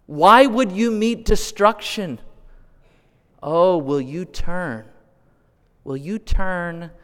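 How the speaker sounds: high, loud, slow plea to the lost; pleading divine voice, slow and aching; God pleading. Acted. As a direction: let God beg them to turn back. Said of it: "he says".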